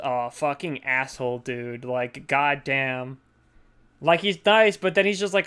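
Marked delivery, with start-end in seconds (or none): none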